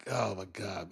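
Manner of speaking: normal talking voice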